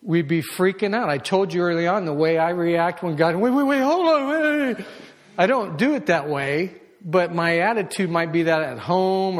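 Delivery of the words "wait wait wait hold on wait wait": high, loud frantic wait wait; panicked stalling. Retold or imitated. Pastor mimics himself panicking at God, frantic and high. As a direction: beg God to hold on in a panic.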